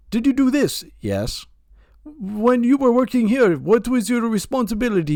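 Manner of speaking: imitating a pushy Indian recruiter, high and rushed; Indian telemarketer accent. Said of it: "stereotypical telemarketer".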